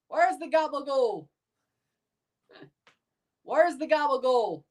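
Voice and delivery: high-pitched New Jersey caricature; New Jersey accent. Shown as a demonstration. Streamer performing on chat's request, hammed-up New Jersey accent. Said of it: "Can you do a New Jersey accent?".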